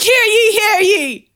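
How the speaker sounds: loud, high proclamation; town crier. Retold or imitated. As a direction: call out to the whole square. Man announcing at courthouse.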